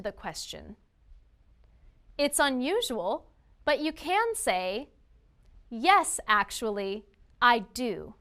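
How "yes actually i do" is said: Yes punched, then actually, I do said plainly; stress on Yes. Shown as a demonstration. Refusing a request politely, with Yes stressed. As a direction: hit Yes firmly so the disagreement is clear. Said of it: "Notice how I emphasized the word yes".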